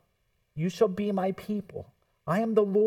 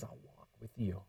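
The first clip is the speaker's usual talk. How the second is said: soft, much quieter, gentle reassurance; God's tender promise; 6 semitones lower, 15 dB quieter than usual. Acted. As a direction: say it like a promise whispered close. God's promise quoted softly.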